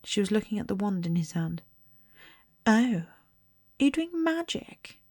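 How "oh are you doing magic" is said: put-on much higher, eager bossy girl; prim girl, keen to see magic. A narrator's reading. Hermione spotting Ron's wand, higher eager bossy girl. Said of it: "She had a bossy sort of voice".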